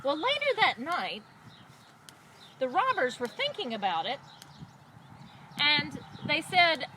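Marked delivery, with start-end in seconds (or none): none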